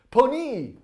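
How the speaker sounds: put-on high, loud French-accented flourish; terrible French accent. Live streamer ends the French poem, loud mock French accent. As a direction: call it out grandly in bad French. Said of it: "with my terrible French accent".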